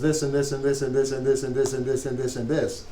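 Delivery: monotone string of repeated phrases without a break; endless run-on. Shown as a demonstration. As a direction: drone it on like a list that never ends. Mimics an endless run-on sentence.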